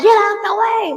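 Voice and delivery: high loud shouting at cars; yelling at traffic. Retold or imitated. Mother yells at cars at red light, high loud shout. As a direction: bellow them out of your way. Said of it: "I'm like".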